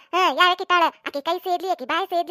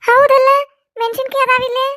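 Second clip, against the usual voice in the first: surprised; 6 semitones higher, 6 dB louder than usual. Acted. Sudden realisation.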